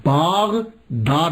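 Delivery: باغداد drawn out very slowly with a long aa after ب; original pronunciation. Shown as a demonstration. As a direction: spell out باغداد slowly, long open باغ. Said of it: "اصل میں اس کا pronunciation". (باغداد کو بہت آہستہ، لمبے الف کے ساتھ)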